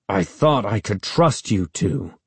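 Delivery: angry words through gritted teeth. A narrator's reading. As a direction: hold the jaw tight, speak through teeth. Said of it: "talking through gritted teeth".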